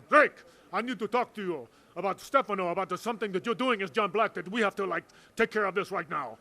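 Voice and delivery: loud, urgent, mock-booming Joe; booming retold demand. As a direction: bark it out like a big boss demanding a word. Joe bursting in about Stefano.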